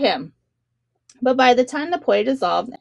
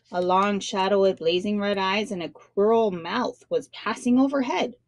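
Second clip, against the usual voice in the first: ordinary delivery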